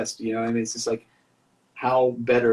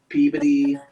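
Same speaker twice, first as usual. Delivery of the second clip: Peabody said loud, in a Massachusetts accent; angry Massachusetts accent; 2 semitones higher, 6 dB louder than usual. Shown as a demonstration. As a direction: throw out Peabody like an irritated Massachusetts local. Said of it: "say everything like I'm angry".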